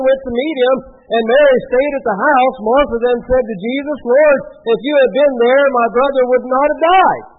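projected, steady Bible reading; scripture reading. A narrator's reading. Preacher reads Martha's meeting with Jesus to the congregation, projected scripture reading. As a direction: read the passage out clearly to the room.

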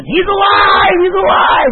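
high, full-voiced shout; screaming yell. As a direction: yell it so people across a river can hear. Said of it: "I was yelling so they could hear me".

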